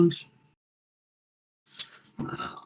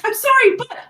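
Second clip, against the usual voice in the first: high, louder apology; much higher nervous sorry; Kev apologising; 14 semitones higher, 5 dB louder than usual. Acted. Kev apologises to the prince before showing the keys, high nervous apology. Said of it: "He's like".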